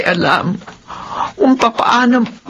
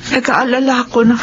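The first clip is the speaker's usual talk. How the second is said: ordinary delivery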